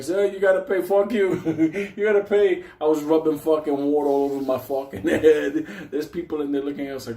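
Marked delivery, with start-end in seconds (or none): 0.0-2.6 high, loud mock clerk yell. Clerk yelling at the thieves grabbing water, high mock yell. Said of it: "he's like". 2.8-5.7 laughing through the words; can't hold in the laugh. Real, not acted. Telling the crowd about the water, laughing through it.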